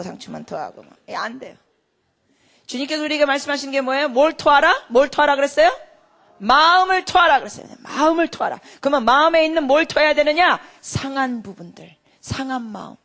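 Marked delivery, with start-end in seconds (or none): 2.7-10.6 loud, high preaching; fiery preaching. Acted. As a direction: drive it home to the congregation. Preaching at full force. (힘차게 외치는 설교)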